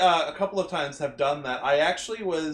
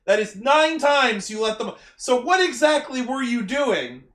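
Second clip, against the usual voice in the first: raised, pointed mock scolding; mock exasperated rant; 6 semitones higher, 4 dB louder than usual. Podcaster demanding what the team was doing, mock exasperated. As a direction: demand an answer, playfully exasperated.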